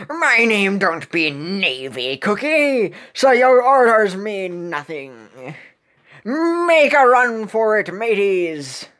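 slow pirate bellow, rising on the escape order; defiant pirate captain. Acted. Pirate refusing navy orders, defiant pirate voice. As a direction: defy them in pirate speak.

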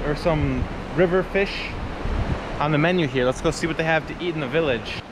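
ordinary talk, nothing marked

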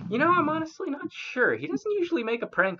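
fairy voice. Acted. Fairy answers player.

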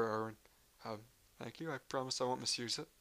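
ordinary delivery

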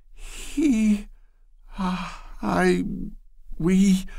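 high, halting false starts; floundering. A narrator's reading. Loretta flounders before Bashford, high and halting. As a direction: stumble for the words. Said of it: "she began flounderingly".

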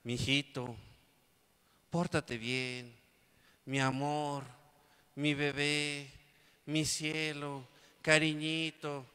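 lightly voiced pampering mom, slow; a hint of a syrupy mom; doting mother. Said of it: "primero están".

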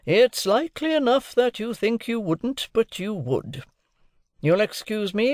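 high, deliberate instructions; overbearing lawyer. A narrator's reading. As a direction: take charge like a cross-examiner. Lawyer figure instructs his ward.

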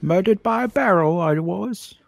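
bad Cockney accent. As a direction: put on a hammy Cockney. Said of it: "everyone just goes to Cockney".

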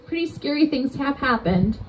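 ordinary talk, nothing marked

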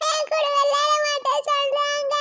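high, slow wailing complaint; bawling child. Acted. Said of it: "இவ வேற கத்துறாளே".

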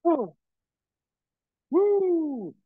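high whooping cheer; excited whoop. Real, not acted. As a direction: whoop it out mid-set. Workout exertion cheer.

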